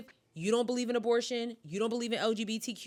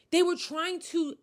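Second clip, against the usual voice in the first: outraged disbelief; 8 semitones higher than usual. Real, not acted. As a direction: pitch climbing on a stretched, incredulous dashiki. Outraged retelling of expulsion.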